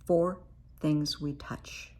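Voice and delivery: ordinary delivery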